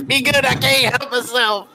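Lightning Red voice. Acted. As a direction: toss it off mid-laugh as you walk away. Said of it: "a fit of shoulder shaking laughter".

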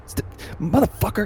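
high yelled stop; shouting. Acted. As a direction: scream after the fleeing man. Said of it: "he just shouts out and he said".

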